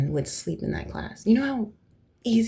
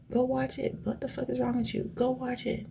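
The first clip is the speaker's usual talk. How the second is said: higher-pitched, insistent exasperation; exasperated urging; 6 semitones higher than usual. Real, not acted. Refusing to describe the movie to listeners, exasperated urging. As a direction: urge them in a raised pitch, without shouting.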